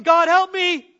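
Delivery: sudden loud, high blurt of fear; mock frightened plea; panicked prayer. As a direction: cry out as the crash comes. Driver about to crash, praying.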